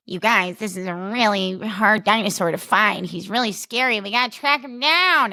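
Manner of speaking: nasal, geeky American impression; mock a geeky, nasal hero; Jonathan Bailey. Podcaster mocking an actor's American accent in Jurassic Park, nasal and geeky. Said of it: "nasal and, like, kinda geeky".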